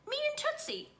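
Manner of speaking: high, lightly voiced small boy; a little kid proudly explaining. Fudge, small child voice.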